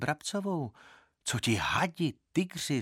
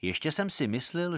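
plain reading voice

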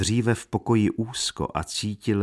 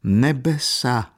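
slow, higher, louder cry of dismay; startled exclamation; 5 semitones higher, 5 dB louder, much slower than usual. A narrator's reading. Old woman finding Katerina in tears, crying out. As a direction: exclaim it, stretching the word in shock. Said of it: "zvolala stařena".

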